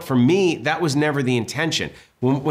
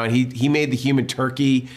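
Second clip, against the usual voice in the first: usual speaking voice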